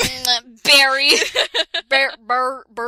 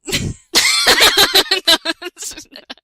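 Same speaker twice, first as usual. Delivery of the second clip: big burst of laughter; loud laugh; 6 semitones higher, 8 dB louder than usual. Real, not acted. Laughing at own reading.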